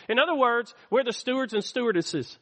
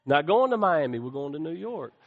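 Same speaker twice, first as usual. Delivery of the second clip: lightly acted, deadpan airline refusal; mock firm crew reply; 5 semitones lower than usual. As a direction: turn the passenger down, low and final. Acted crew answering the passenger.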